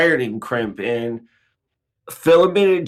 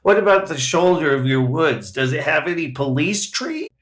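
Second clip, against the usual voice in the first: ordinary talk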